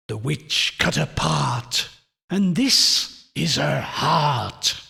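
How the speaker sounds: slow, drawn-out ghoulish chant; eerie intoning. A narrator's reading. Husband handing out the witch's heart, intoning. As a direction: intone each phrase with long, spooky weight. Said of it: "intoning the words".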